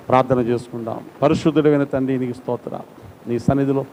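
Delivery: usual speaking voice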